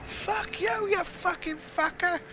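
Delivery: very high, slow mock yell; mock rage. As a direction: rant at the enemy ships. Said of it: "I'm just like".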